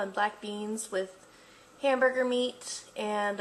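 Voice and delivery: ordinary talk, nothing marked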